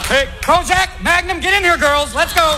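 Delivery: put-on calling the kids. Father calling his kids.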